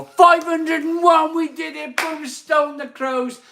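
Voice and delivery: elated, high-pitched victory cry; jubilant shout. Real, not acted. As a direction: yell it like a winning goal. Target reached, celebrating.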